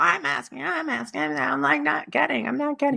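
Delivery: high, pleading mock complaint of frustrated people; high mock whine of a stuck seeker; whiny asker. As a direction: whine it up high like someone who never gets answers. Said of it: "so many people".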